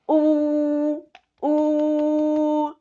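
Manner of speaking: a held U with the pencil pressed against the teeth; vowel U with pencil. Shown as a demonstration. Teacher showing students the closed vowel U. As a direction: round the lips on the U, teeth closed. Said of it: "a ver qué pasa".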